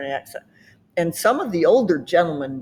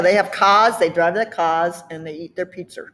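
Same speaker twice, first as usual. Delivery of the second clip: Boston R-less 'cars', 'pizza' ending in R; Boston accent. Shown as a demonstration. Summing up Boston talk, Boston accent. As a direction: do Bostonian 'cahs' and 'peetser'. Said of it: "put R on that one and take the R off".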